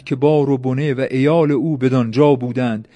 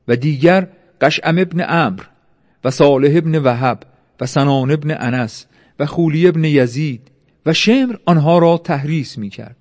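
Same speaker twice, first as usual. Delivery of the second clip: ordinary delivery, nothing marked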